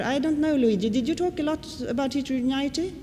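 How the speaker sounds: usual speaking voice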